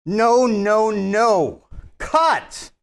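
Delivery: loud, high call to stop the take; shouted cut. Acted. Director shouting cut to stop the scene, loud and high. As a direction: yell cut across the set.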